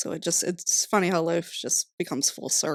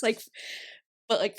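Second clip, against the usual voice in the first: ordinary talk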